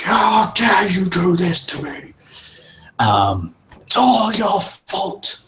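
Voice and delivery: high, loud, raging accusation; furious chief yelling. Acted. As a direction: roar the blame at her like a betrayed chief. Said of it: "yelling at the top of his lungs".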